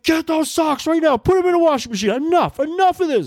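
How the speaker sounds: dad finally snaps at his daughter, yelling high; explosion. Retold or imitated. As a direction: blow up at the daughter over the socks. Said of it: "That's when the explosion happens".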